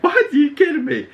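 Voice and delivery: a sudden very high squeal of disbelief; can't believe my eyes; shocked disbelief. Retold or imitated. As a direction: leap way up in pitch, stunned. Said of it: "I was like".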